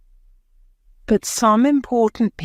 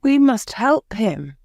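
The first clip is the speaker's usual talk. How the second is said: higher, mildly urgent plea; earnest cry; 6 semitones higher than usual. A narrator's reading. Bobby pleading to help the Russian, mildly crying out. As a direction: urge it with a light cry. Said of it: "cried Bobby".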